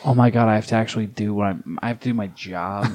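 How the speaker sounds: put-upon worker, quietly exasperated; exasperated sigh. Acted. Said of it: "the exasperated sigh of".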